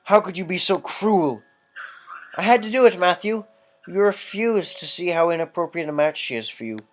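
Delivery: voicing a wounded sister and a stern brother in turn; dramatic period drama dialogue. Gamer voicing a family quarrel, heightened and theatrical. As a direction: read the quarrel like an old melodrama. Voices game dialogue scene.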